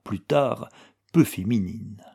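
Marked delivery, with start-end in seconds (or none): none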